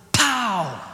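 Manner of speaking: high, drawn-out "pow" falling away; a long exclaimed "pow" swooping down in pitch; imitated pow. Preacher acting out the washing machine suddenly coming free, drawn-out pow.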